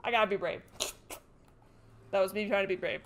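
usual speaking voice

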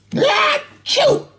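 a big high acted sneeze; loud sneeze. Narrator sneezing with a cold, big sneeze.

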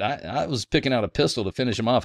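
usual speaking voice

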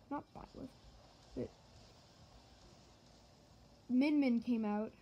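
usual speaking voice

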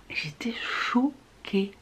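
slow, soft whisper; shocked whisper. Real, not acted. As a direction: whisper it slowly, stunned. Commenting on her shock.

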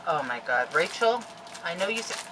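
normal talking voice

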